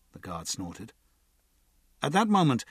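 usual speaking voice

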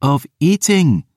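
put-on sharp young woman's snap; snapping. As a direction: snap at him. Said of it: "she snapped".

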